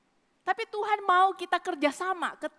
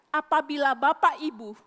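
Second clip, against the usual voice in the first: ordinary talk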